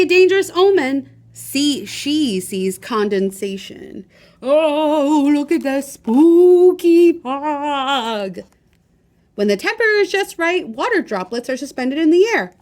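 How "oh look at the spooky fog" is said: slow, louder mock-spooky voice; hammed-up eerie wonder; spooky awe. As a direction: draw it out like a ghost story. Fairies see a spooky omen.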